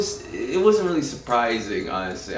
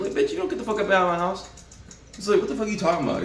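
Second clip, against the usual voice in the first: high, fast indignant rant; mock outraged rant; 10 semitones higher, much faster than usual. Playing the drug dealer telling Rue off, fast and outraged.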